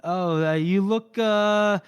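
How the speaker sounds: halting mock awkwardness, pitch creeping up; fumbling compliment. Flustered man trying to compliment a woman, fumbling. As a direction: grope for words, imitating a flustered man.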